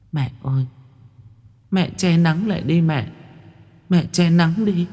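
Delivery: put-on high-pitched child in pain; ailing daughter calling to mother; pained little girl. A narrator's reading. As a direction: plead in a small, high child's voice. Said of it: "con bé Mười đau đớn".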